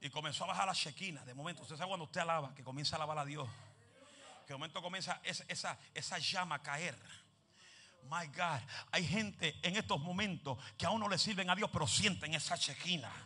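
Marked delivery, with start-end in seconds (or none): none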